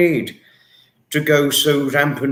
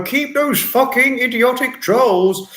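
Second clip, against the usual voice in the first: high, hammed-up booming order; theatrical celebrity impression, pitched up; Brian Blessed; 7 semitones higher than usual. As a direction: declaim it high like a blustering actor. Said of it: "or Brian Blessed would say this".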